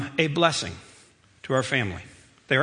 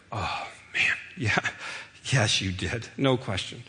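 tender reassurance; 3 semitones lower, 6 dB quieter than usual. Retold or imitated. Father answering son tenderly.

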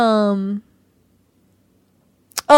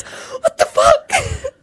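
much higher, slow, shrieking and laughing at once; shocked shriek; much slower than usual. Retold or imitated. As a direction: stretch it out in laughing disbelief. Wife reacts to the spit take.

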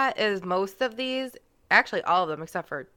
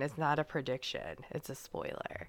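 whispered aside; 5 semitones lower, 12 dB quieter than usual. Real, not acted. Teasing aside to husband.

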